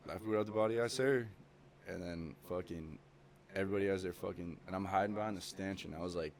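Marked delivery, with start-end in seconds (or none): 0.1-1.3 loud, higher echo of the order; mock platoon yelling reply; recruits shouting back.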